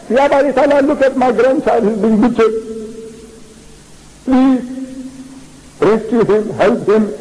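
imploring God for rescue; pleading prayer. Retold or imitated. Prophet begs Allah for his butchered grandchild, pleading.

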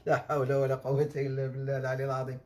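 normal talking voice

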